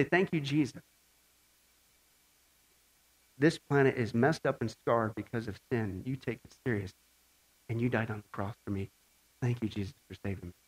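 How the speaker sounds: low, soft thanks to Jesus; quiet prayer. Acted. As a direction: pray it softly and low.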